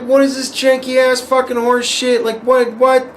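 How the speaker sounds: high, indignant rant; a high-pitched retold rant at the clerk; retold indignation. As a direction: squeal it in mock outrage. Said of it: "I'm like".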